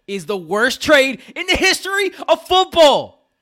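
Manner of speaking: high, loud, slow outraged declaration; heated rant. Real, not acted. Fan rants to his cohosts about the trade, outraged and loud. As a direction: hammer home the verdict like it's gospel.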